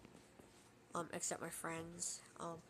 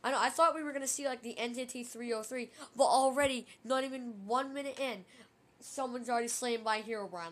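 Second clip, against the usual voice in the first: excited; 4 semitones higher, 8 dB louder than usual. Real, not acted. Hyped after Herobrine appears.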